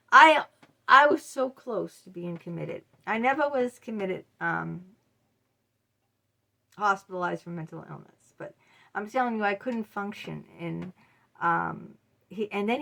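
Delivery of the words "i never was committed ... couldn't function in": normal talking voice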